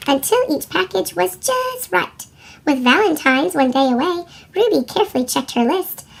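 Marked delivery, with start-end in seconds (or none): none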